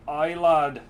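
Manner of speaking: weak Scottish accent attempt; half-hearted Scottish brogue; bad Scottish accent. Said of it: "your Scottish accent is bad".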